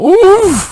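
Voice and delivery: high squeaky oof; sudden pained oof; imitated grunt of pain. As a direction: mimic a man hit in the groin. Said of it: "I just hear him go".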